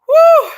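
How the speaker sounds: high, loud whoop of exasperation; upset whew. Real, not acted. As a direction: blow off anger in a sudden high "whoo". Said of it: "I'm gonna calm down a minute".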